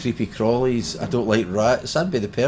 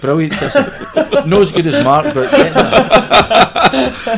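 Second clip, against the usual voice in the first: laughing through a cheeky joke, louder and higher; 12 semitones higher, 10 dB louder, slower than usual. Real, not acted. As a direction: get the words out while cracking up. Joking about a celebrity kisser.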